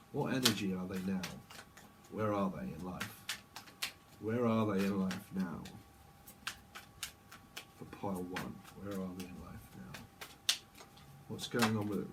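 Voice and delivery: low, hushed questions with long pauses; meditative channelling. Real, not acted. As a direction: murmur questions inwardly while tuning in. Channels a reading, asking slowly.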